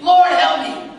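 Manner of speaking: high, loud plea for help; a sinner calling out for help, loudly; desperate cry. Acted. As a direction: cry out to the Lord. Voicing a desperate believer's cry.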